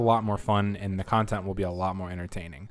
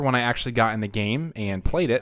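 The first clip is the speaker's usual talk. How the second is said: ordinary talk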